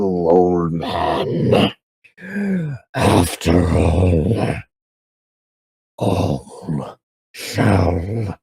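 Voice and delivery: low, slow, menacing voice of a rotting horror; dark creature promising decay, low and slow; rotting monster. Acted. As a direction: drag out each doom-laden word, deep and slow. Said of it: "who in return goes".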